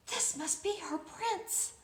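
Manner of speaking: plain reading voice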